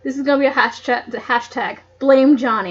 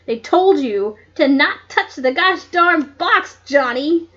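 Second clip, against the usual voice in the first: mock outrage; 2 semitones higher, 5 dB louder than usual. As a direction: chew Johnny out in playful fury. Reader rants at character Johnny.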